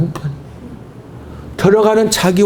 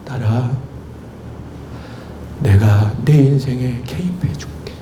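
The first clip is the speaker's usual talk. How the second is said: tender divine whisper; 5 semitones lower than usual. Retold or imitated. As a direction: breathe it gently, almost unvoiced. Jesus speaking in vision.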